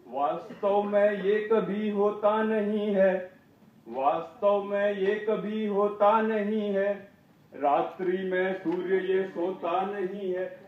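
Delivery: slow, measured verse with stretched words; poem recitation. Acted. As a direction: declaim each line in an even cadence. Recites own poem on stage.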